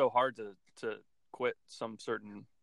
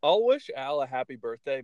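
ordinary talk

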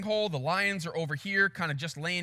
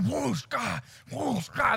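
higher-pitched swoosh and growl effect; rushing swoosh, then a throaty burst; imitated chasing lions; 4 semitones higher, much slower than usual. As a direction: sound out lunging lions. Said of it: "The lions going".

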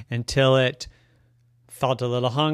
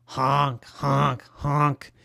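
goose honking; much slower than usual. Acted. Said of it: "He honks".

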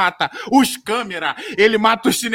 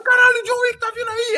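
panicked thugs. Retold or imitated. Thugs realizing whose dog they killed, squeaky panicked high voice. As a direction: squeal it like a scared henchman.

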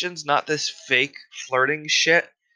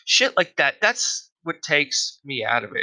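ordinary delivery

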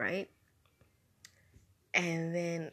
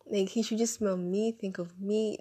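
normal talking voice

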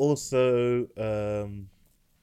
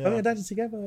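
put-on excited kids; 11 semitones higher, faster than usual. Dad voicing kids' happy cheer.

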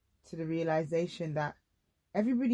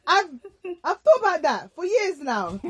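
laughing; 9 semitones higher, 6 dB louder than usual. Real, not acted. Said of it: "laughing at herself".

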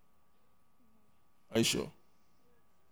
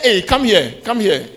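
calling out; 5 semitones higher, 3 dB louder, slower than usual. Retold or imitated. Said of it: "They are saying".

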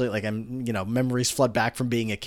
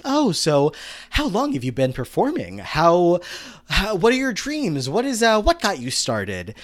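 higher, patronizing interviewer lilt; condescending tone; 6 semitones higher, slower than usual. Retold or imitated. Kurt quizzing Starchild in a condescending tone. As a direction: talk down sweetly to the new guy. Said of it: "condescending tone".

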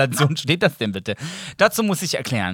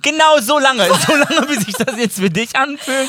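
teasing retort; 9 semitones higher than usual. Acted.

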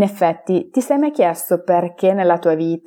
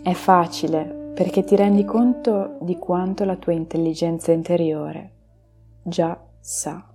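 slow, soft meditation voice; guided meditation; 8 semitones higher, 10 dB quieter than usual. Acted. Leads a relaxation meditation.

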